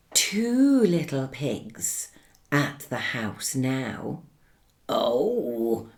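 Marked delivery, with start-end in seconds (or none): 4.9-5.9 hungry wolf. Acted.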